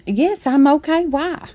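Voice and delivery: high, light imitation of surprised mom; mom puzzled. Mom's surprised reply in retold story.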